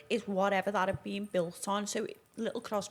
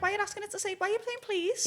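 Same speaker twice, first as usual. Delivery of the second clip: incredulous; faster than usual. Retold or imitated. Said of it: "I'm like".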